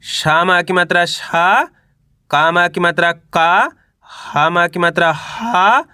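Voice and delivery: very slow, drawn out syllable by syllable; patient teacher spelling the word aloud; slow syllable reading. Shown as a demonstration. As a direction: sound out each letter for young learners. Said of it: "दो दो करके पढ़ना है".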